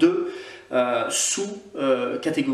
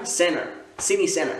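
passing for an American, higher pitch; American accent; 4 semitones higher than usual. Shown as a demonstration. English teacher posing as an American to show imitation, American accent. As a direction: put on an American accent, T dropped in "Center". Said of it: "je me fais passer pour un américain".

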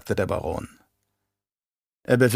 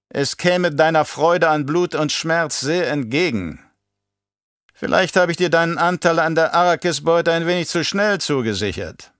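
lightly voiced taunting baron, raised pitch; spöttelnder Baron; 6 semitones higher than usual. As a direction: tease him as a haughty baron. (leicht angedeutete Baronstimme, höhnisch)

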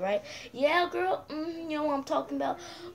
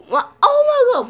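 pained outcry; 12 semitones higher, 14 dB louder than usual. Acted. Reacting to a hit eye.

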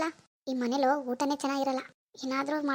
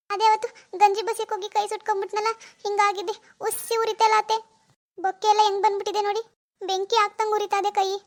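higher-pitched feigned plaintive whine; put-on helpless pleading, pitched up; playing the sympathy card; 5 semitones higher, 3 dB louder than usual. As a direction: fake a painful injury to win pity. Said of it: "ನನ್ sympathy ಕಾರ್ಡ್ನೇ use ಮಾಡ್ಬೇಕು".

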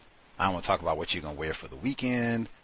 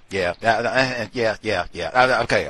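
normal talking voice

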